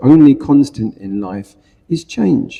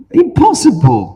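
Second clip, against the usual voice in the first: genie voice; 13 semitones higher than usual. Acted. Genie refuses the wish.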